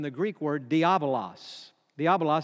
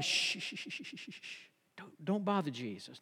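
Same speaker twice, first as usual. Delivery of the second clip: soft shushes into hushed words; acted onlooker's hush; crowd hushing; 11 dB quieter than usual. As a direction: shush someone away, keeping your volume down. Crowd stopping man reaching Jesus.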